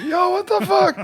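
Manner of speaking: high, startled cry with a laugh in it; shocked yell. Retold or imitated. Touring musician spotting a knife-wielding cook, shocked high yelp. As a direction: squeal it out in disbelief, half laughing.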